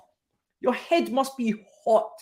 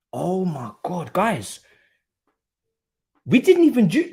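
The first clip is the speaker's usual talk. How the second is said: normal talking voice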